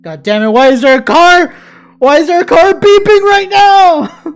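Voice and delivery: angry yell. Real, not acted. Fed up with a car beeping outside, angry yell.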